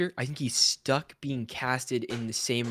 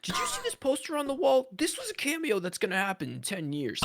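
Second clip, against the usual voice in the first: high, excited mocking fan voice; mock hype; 7 semitones higher than usual. Mocking hyped fan theories.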